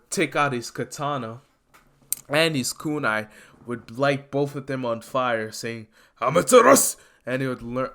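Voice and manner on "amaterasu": attack call. Acted. Izuku calling his attack name.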